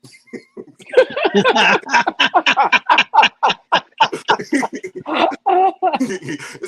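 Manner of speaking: big burst of laughter; laughing hard out loud; long loud laugh. Real, not acted. Big laugh at own story.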